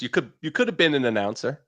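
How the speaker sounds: usual speaking voice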